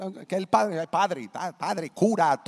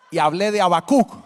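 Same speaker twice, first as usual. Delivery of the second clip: preaching shout; 4 semitones higher, 8 dB louder than usual. Real, not acted. Starts preaching loudly.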